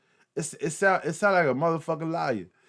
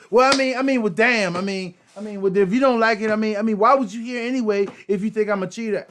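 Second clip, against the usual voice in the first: high, loud, whiny mock defensiveness; mocking cheating girlfriend; 8 semitones higher, 6 dB louder than usual. Mocking a cheating girlfriend who deflects the blame onto her partner. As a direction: ham up a caught cheater talking her way out. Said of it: "a female that cheats on you ... she just says".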